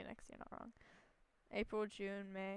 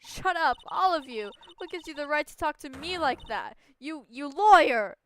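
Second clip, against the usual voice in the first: April May yelling, louder and higher; furious witness shout; 6 semitones higher, 14 dB louder than usual. Acted. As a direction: snap back at the lawyers in a rage. Voices April May outburst.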